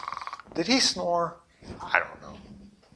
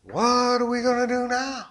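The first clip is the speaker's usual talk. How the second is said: panicked fishermen; 5 semitones higher, 11 dB quieter than usual. Retold or imitated. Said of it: "they're like".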